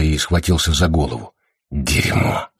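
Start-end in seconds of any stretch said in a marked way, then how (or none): 1.7-2.5 low, voiceless curse; pained curse. A narrator's reading. Patient clutching his head, cursing in pain. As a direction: swear under a stab of pain.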